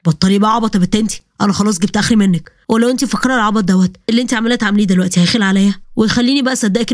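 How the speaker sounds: a man snapping at his sister; angry brother. Acted. Walid confronts sister. (أخ غاضب بصوت رجالي منخفض)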